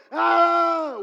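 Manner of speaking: sudden loud shout; a burst of a shout at home; yell. Retold or imitated. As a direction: let out a raw yell like you used to. Demonstrating his own yelling.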